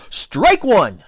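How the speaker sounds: loud, high strike call; umpire's shout. A narrator's reading. Both umpires calling the pitch, shouted. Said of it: "shouted both umpires together".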